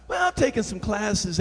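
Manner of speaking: soft mock reply; meek answerer. Church member dodging the preacher's question, meek mock voice. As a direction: imitate someone hedging quietly.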